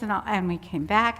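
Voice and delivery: ordinary talk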